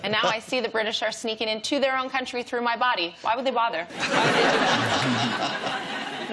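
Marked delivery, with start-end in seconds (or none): 3.8-6.3 drawn-out mock bafflement. Mock baffled repeat of question.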